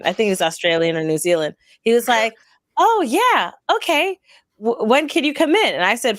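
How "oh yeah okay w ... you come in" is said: high, agreeable phone voice; lilting, higher-pitched imitation of a man; accented receptionist. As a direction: imitate an accommodating shop worker, pitched up. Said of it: "the guy who had an accent".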